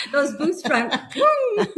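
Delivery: loud, high, laughing woo; laughing cheer. Real, not acted. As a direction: whoop with laughter. Cheering friend's boots.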